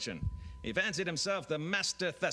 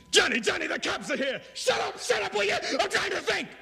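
frantic high yelling gangster; intense drama shout; 5 dB louder than usual. Acted. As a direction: overwrought melodrama, shouting high. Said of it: "Playhouse ninety. Ninety-second dramas that are very intense".

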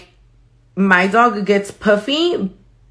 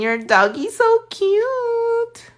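put-on very high, slow cooing baby voice; high slow baby-talk coo; slower than usual. Cooing at a viewer's dog.

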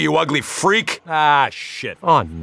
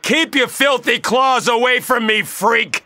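shout; 7 semitones higher, slower than usual. Acted. Combat taunt at monster.

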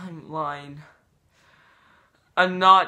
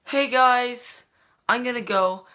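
bright, higher, louder vlogger hello; peppy greeting; 7 semitones higher, 4 dB louder than usual. Acted. Vlogger opening a new video, hyped higher greeting. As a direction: greet the fans like a hyped youtuber.

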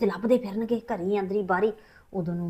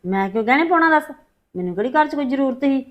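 indignant; 4 semitones higher, 6 dB louder than usual. Acted. Wife defends herself to husband.

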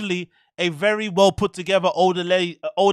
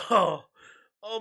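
usual speaking voice

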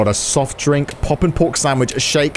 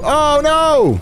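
dismayed; 11 semitones higher than usual. Real, not acted. Customer storms off.

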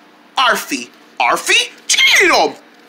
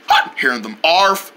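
normal talking voice

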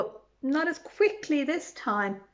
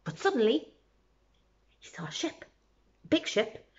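plain reading voice

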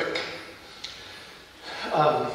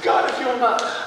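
higher reenacted cry, pitched well up; reenacted shout to God; 7 semitones higher than usual. Wounded soldier calling up to God, reenacted high cry. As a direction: cry it out again like on the battlefield. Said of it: "I shouted, I said".

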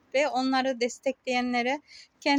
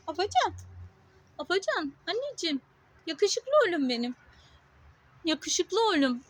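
cooing to a cat; 4 semitones higher than usual. Real, not acted. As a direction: fuss over the cat in a high coo. Petting the cat, sweet talk. (kediye yüksek, şefkatli bebek sesi)

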